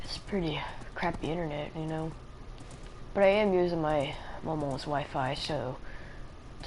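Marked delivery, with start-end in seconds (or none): 0.0-2.1 whispered aside. Real, not acted. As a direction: whisper it as a complaint. Muttered aside about bad Wi-Fi.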